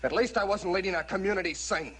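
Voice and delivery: high-pitched, indignant comeback; angry retort. Acted. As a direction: argue back at the officer, stung. Quarrel, snapping back at officer.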